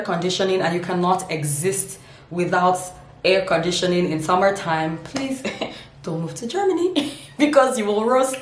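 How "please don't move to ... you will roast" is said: laughing through the warning, pitched up; real laughter breaking into the line. Real, not acted. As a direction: giggle out the words as you say them. Laughing at own joke.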